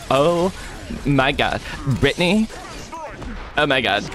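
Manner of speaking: uptalk, mock Valley Girl gushing. Shown as a demonstration. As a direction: gush like a teen at the mall. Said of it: "Valley Girl voice as a joke".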